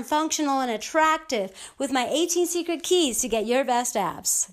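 ordinary delivery

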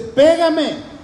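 half-acted defiant cry; retold yell. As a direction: street preacher shouting back at his attacker, mock yell. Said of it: "el otro grita y le dice".

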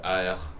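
AH as a throaty French R; French R demo. Shown as a demonstration. Showing cohost how the French R feels in the throat, throaty French R. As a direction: sound out the French R as a scraped AH in the throat. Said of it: "la R en francés es".

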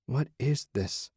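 quiet, wary question; cautious question. A narrator's reading. Susan in the strange nursery, asking quietly and cautiously. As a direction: ask carefully, half afraid of the answer. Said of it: "she asked cautiously".

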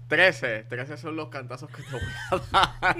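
hammed-up movie-hero shout; Zack, héroe de película. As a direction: shout it like a trailer one-liner, laughing. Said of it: "viene Zack y dice". (grito exagerado de héroe de película)

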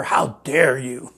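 slow, mocking "How dare you?"; mock the outraged young activist, lightly; a light slow mimic of an indignant activist; Greta Thunberg impression. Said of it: "Greta Thunberg or whatever?".

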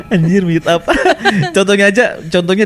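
real burst of laughter; laughing. Real, not acted. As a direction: laugh through the words. (tertawa lepas)

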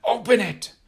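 high, loud furious shout. A narrator's reading. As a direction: bark the order at full force. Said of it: "Uncle C shouted".

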